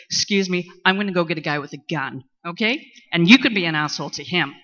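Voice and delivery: sassy put-down from behind bulletproof glass; mock snippy cop. Ex-police clerk imitating herself snubbing a jerk, loud sarcasm.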